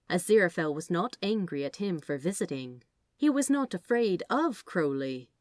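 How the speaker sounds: usual speaking voice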